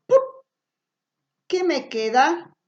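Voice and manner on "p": "pup" as a short lip pop; isolated p sound. Shown as a demonstration. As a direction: say "pup" as just a puff of p. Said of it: "cambiarlo por el sonido".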